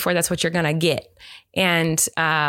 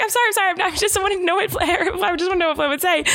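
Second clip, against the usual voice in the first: high, giggly backpedaling; retold panicky sorry with laughter breaking in; flustered apology; 12 semitones higher than usual. Podcaster replays apologizing to startled husband, high and flustered.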